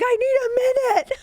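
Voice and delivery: high-pitched, loud comic wail; imitating a hysterical plea, voice way up high; mock hysterical crying. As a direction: wail it like a hammed-up sobbing breakdown. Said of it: "hysterically crying".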